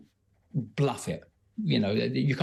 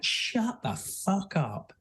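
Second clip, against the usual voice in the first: drawn-out, higher mock shout; retold shout; 7 semitones higher, much slower than usual. Friend shouting down the line of prisoners, imitated shout. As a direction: shout it slowly without real volume. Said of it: "he just shouted at me".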